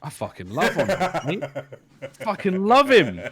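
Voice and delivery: loud, drawn-out love declaration; slow, emphatic fan gushing; excited gush. Real, not acted. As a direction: gush about him with big emphasis. Enthusiastic declaration about Envoy.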